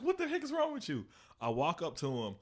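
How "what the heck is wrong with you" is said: high, laughing "what the heck"; retold laughing question to a coworker; laughing disbelief. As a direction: ask it while cracking up. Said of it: "I'm like".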